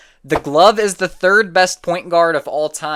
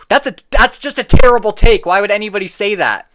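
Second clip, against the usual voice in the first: exasperated; 5 semitones higher, 7 dB louder than usual. Real, not acted. Ranting at terrible take.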